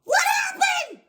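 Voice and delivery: screaming high and loud in panic; panicked scream. Retold or imitated. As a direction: shriek it out like you just saw blood. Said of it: "I scream".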